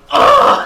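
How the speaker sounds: very loud, high exasperated groan; frustrated groan. Acted. Reacting to being caught off guard, loud frustrated groan. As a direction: burst out with a frustrated "ugh". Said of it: "he's, like, frustrated".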